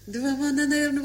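ordinary delivery

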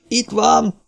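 high cry of discovery; urgent high-pitched call; blaring shout. A narrator's reading. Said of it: "harsant Cartwright kiáltása".

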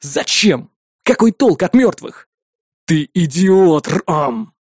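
loud rebuke slowing into an emphatic insult; angry outburst. A narrator's reading. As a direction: berate him, drawing out the insult. Rages at brother over the killings.

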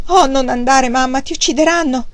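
high, loud frightened begging; acted panicky appeal; alarmed plea. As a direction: plead in alarm for her not to go. Fanny begs mother not to go.

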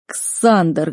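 plain reading voice

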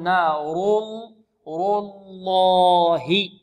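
very slow "نار الله", dark L held long; heavy r and lam. Shown as a demonstration. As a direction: stretch "الله" out with a thick L after a heavy ر. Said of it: "الراء مفخمة واللام من لفظ الجلالة مفخمة". (تفخيم الراء واللام في "نار الله" ببطء شديد)

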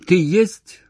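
loud, high urgent question; anxious, near tears. A narrator's reading. Woman unsure he is on the line, anxious. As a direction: desperately check he is there. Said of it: "Наверное, она плакала".

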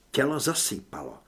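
usual speaking voice

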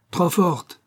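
high-pitched outcry; indignant exclamation. A narrator's reading. Young client protests at the director's impudence, crying out. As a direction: cry it out, pitch jumping up. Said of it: "s'écria-t-il".

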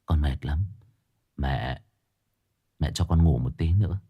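weak, soft, drained voice; exhausted son begging his mother, feeble; feeble rasp. A narrator's reading. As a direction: barely get the words out, parched. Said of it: "cổ họng khô khốc thều thào".

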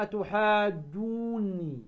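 "أتحاجوني" very slow, with the waw before the doubled nun held long; Quranic recitation. Shown as a demonstration. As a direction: recite "أتحاجوني" and stretch the waw for six counts. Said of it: "أيضًا قوله تعالى". (ترتيل "أتحاجوني" بمد الواو ست حركات)

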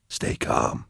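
hushed low whisper; very soft, calming whisper. A narrator's reading. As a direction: whisper it to steady someone panicking. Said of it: "Why are you whispering?".

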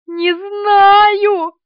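high, loud drawn-out wail; panicked squeal. A narrator's reading. Woman cannot tell where the roach is, squealing. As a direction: squeal it helplessly. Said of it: "повизгивала Полина".

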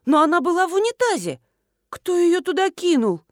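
high, indignant astonishment; exclaiming. A narrator's reading. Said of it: "воскликнула я".

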